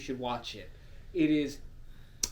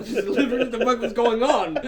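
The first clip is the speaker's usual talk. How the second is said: ordinary talk, nothing marked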